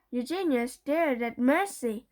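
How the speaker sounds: plain reading voice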